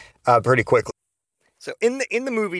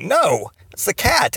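higher, drawn-out mock-exasperated no; exasperated correction; 8 semitones higher, 4 dB louder, slower than usual. Boyfriend correcting her tumor guess, exasperated. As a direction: stretch the denial out, pitched up. Acts out his correcting reply.

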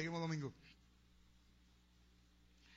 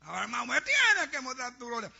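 loud, raised mock demand; shouting at the altar; 5 semitones higher, 8 dB louder than usual. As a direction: imitate a zealot insisting before God. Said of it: "gritando en el altar". (exigencia gritada, en imitación)

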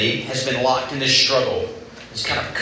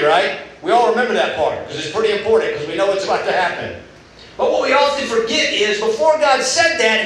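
normal talking voice

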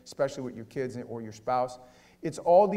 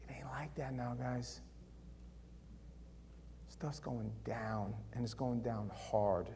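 whisper; 4 semitones lower, 12 dB quieter, slower than usual. Real, not acted. Warning hushed to congregation.

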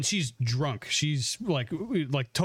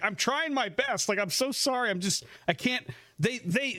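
pitched-up, pleading imitation of a flustered cop; mock desperate apology; 7 semitones higher than usual. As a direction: plead haltingly, higher than your own voice. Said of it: "he was just trying his best".

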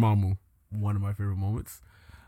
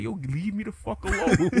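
high-pitched mock exasperation; pissed, half laughing; 7 semitones higher than usual. Dormammu begging Strange to go, laughing mock annoyance. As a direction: plead crossly through a laugh. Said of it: "he was so pissed".

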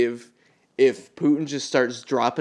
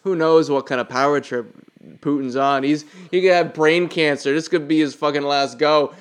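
ordinary talk, nothing marked

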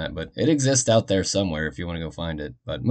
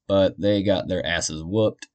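ordinary talk, nothing marked

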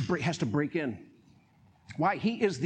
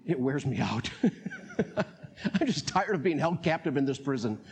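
ordinary delivery

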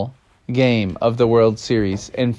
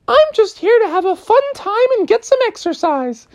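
very high gentle kitten voice; sweet kitten. Acted. Kitten replying to Froggy, very high and sweet. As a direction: answer innocently.

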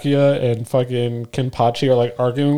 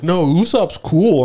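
higher, dragged-out fan imitation; mock fan; 6 semitones higher, 3 dB louder, much slower than usual. People insisting Usopp is cool, mocking. Mimicking fans defending Usopp.

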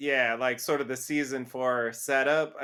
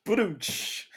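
imitated drum sting after a pun; punchline drum hit done by mouth; mouthed rimshot; 5 semitones higher than usual. Podcaster answering co-host's pun, mock rimshot.

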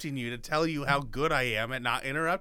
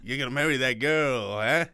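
pushy Italian-Irish pal, louder; Irish-Italian mock accent; 4 dB louder than usual. Co-host pressing Frank in a loud Irish-Italian accent. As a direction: lean in like a Brooklyn-Italian buddy. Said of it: "some Irish or, like, Italian stuff".

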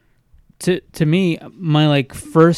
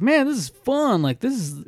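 retelling an excited thought, higher at first; fired up; 10 semitones higher than usual. Retold or imitated. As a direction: replay being pumped about it, lightly. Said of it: "kinda fired up".

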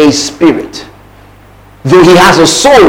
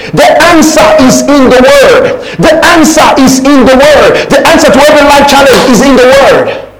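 fervent full-force preaching; 5 semitones higher than usual. Acted. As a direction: preach it with fire.

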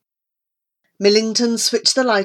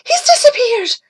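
high, loud panicked voice; alarmed; 6 dB louder than usual. Acted. Amy finding the boy's bed empty, high and panicked. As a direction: sound frightened for the missing boy.

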